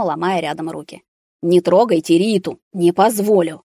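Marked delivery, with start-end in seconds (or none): none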